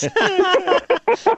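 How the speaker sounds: high delighted laughter. Real, not acted. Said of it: "That was very kind".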